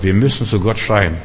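normal talking voice

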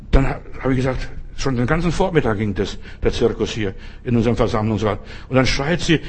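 normal talking voice

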